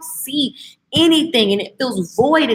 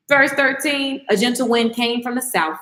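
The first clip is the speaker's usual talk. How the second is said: ordinary talk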